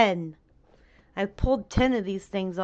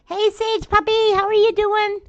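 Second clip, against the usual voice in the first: high, bright hello to a friend; excited greeting; 12 semitones higher, 7 dB louder than usual. Real, not acted. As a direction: sing out a cheery hello. Greeting a viewer in chat.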